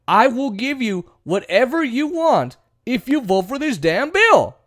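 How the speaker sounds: high-pitched, pleading bargain; emphatic acted promise. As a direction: pitch it up and press every word. Acted promise to win votes.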